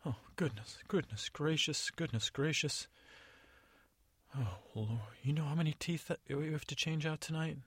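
a mild flustered fairy fretting. Acted. Fairy fretting to the gnome about tonight's teeth. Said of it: "And she said".

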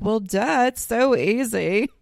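slow, smug mock of people who find it easy; mocking dismissive. Mocking know-it-alls who say it's so easy, smug and slow. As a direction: sneer it out like it's obvious. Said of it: "other people are like".

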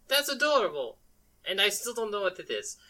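playfully hammed-up delight; charmed character. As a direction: coo it up high, then puzzled. Voicing Jocasta seeing the cat.

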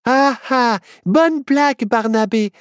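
put-on high-pitched sarcastic owl-girl; high, peeved character retort; grumbling mock laugh. A narrator's reading. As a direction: fake the laugh, unimpressed. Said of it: "grogna Myrtille".

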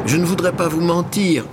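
put-on high, loud young man's voice; earnest young man speaking up; young man Élie. A narrator's reading. Élie to the vouivre, high and loud. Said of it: "ajouta Élie".

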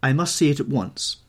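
urgent. Acted. Dorian urges haste.

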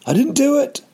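very high squealed mock denial; mock protest. Comedian denying stalking after restraining order joke, squeaky mock protest.

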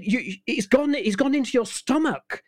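high, alarmed cry of the doctors; mock alarmed doctor. Doctors alarmed as the camera slips into his stomach, high mock panic. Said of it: "They said".

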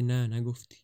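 ordinary delivery, nothing marked

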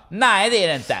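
a flat refusal, pushed out loud; firm retold refusal. The heckler refuses the comedian's apology, firm and loud. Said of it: "De bara".

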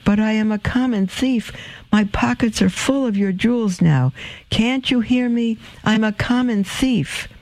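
high, choked confession; thick and incoherent. Acted. Son confessing theft to his mother, choked and thick. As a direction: force out the shameful truth. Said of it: "spoke thickly and incoherently".

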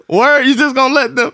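high, loud disbelief; indignant. Retold or imitated. Complaining Sandra just takes the credit, indignant and loud. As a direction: call her out in disbelief.

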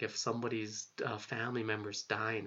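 ordinary talk, nothing marked

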